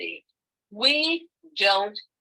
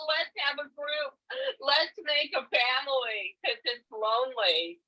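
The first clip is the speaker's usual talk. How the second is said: tearful; 7 semitones higher than usual. Real, not acted. Lonely older woman near tears.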